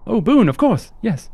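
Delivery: high-pitched, apologetic crew member; chirpy high host voice; eager, polite ship hand Onion. Acted. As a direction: play a courteous, high-voiced deckhand, lilting upward.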